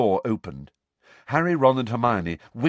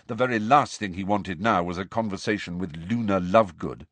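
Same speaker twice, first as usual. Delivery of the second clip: ordinary delivery, nothing marked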